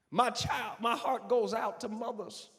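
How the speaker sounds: loud, high preaching climax; full-force preaching. Real, not acted. Pastor preaching to the congregation about mothers, full force. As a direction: preach it to the rafters.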